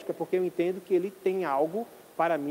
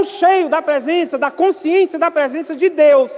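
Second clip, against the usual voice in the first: fiery preaching; 4 semitones higher, 8 dB louder than usual. Acted. Preaching at full force.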